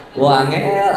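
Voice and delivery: ordinary talk, nothing marked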